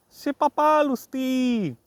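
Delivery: high, loud sing-song calling to the animal; excited calling out. Real, not acted. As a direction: call out high and loud to your pet.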